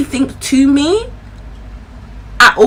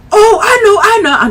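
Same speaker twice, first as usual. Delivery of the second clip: put-on excited boyfriend; 10 semitones higher, 5 dB louder than usual. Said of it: "He was like".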